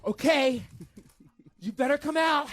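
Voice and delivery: loud, high, slow menace; threatening girlfriend. Retold or imitated. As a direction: warn him through the door. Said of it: "she's like".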